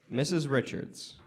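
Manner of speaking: ordinary talk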